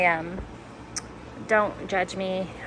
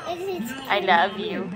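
tender to her son; 2 semitones higher, much slower than usual. Real, not acted.

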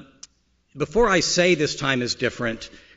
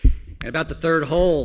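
ordinary delivery

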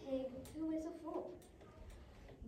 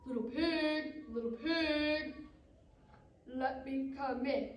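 drawn-out, sing-song wolf call; singsong storybook wolf at the door; 4 dB louder, slower than usual. Acted. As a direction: coax the pig slowly, stretching each word. Wolf calling at pig's door.

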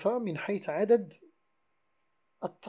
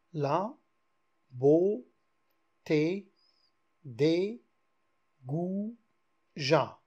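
La, beauté, des, goujats spaced with pauses, beauté louder; syllables spaced out; 4 dB louder, much slower than usual. Shown as a demonstration. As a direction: set each word apart for counting. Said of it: "il y a aussi six syllabes".

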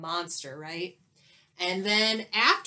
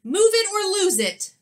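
loud, much higher furious yell; teacher yelling; 11 semitones higher, 10 dB louder than usual. Acted. Teacher yelling at Bobby over a paper airplane, furious. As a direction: bellow it at the naughty boy. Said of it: "she says".

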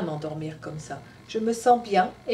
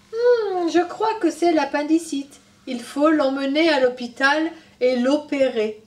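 smiling doctor; 6 semitones higher, 6 dB louder, slower than usual. Acted. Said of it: "En souriant, il a dit".

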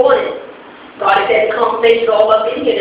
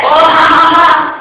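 higher, imitated scoffing laugh; mock laughter; 5 semitones higher, slower than usual. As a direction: act out the crowd laughing. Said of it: "even though they laugh".

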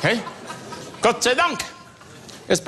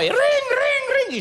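high ringing doorbell imitation; slower than usual. Imitating the doorbell ringing at the front door. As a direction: ring like a shrill doorbell.

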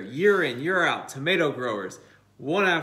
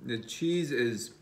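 chewy muffled speech; talking while chewing; 3 semitones lower, 4 dB quieter than usual. Real, not acted. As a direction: mouth partly full of pizza. Seen 1: Man bit pizza at -4, mouth chewing while speaking.

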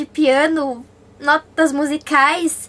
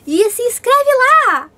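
excited call; 7 semitones higher, 4 dB louder than usual. Acted. Urging viewers to subscribe.

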